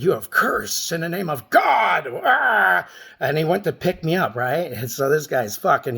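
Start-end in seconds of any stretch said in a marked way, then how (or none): 0.0-2.1 raised, furious shout of accusation; angry shout. Retold or imitated. Big man in Red Lobster shouting at the speaker. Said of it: "he got up and said". 2.2-2.9 startled yelp. Retold or imitated. Startled yelp at being attacked.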